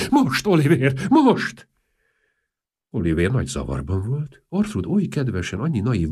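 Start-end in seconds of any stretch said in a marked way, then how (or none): 0.1-1.7 high, loud pleading call; eager pleading shout; urgent plea. Acted. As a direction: press him, rising to a loud cry.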